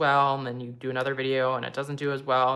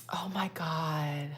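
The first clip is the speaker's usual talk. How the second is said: slow unvoiced awed whisper; 4 dB quieter, slower than usual. Real, not acted. Creator whispering in awe at a viewer's comment. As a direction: breathe it out, overwhelmed.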